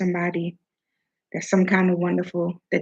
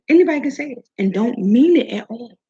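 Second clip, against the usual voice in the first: usual speaking voice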